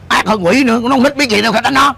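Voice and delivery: acted exasperated rebuke; scolding.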